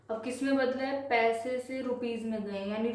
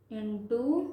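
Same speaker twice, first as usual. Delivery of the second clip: ordinary talk, nothing marked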